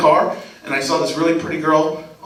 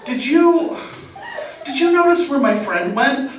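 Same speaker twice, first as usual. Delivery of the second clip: higher, hesitant female voice; girl at the bar; 8 semitones higher, slower than usual. Retold or imitated. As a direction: play a girl asking timidly. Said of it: "this girl came up and she said".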